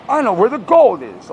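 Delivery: loud, high-pitched mock rap line. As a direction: punch it out like a terrible rapper.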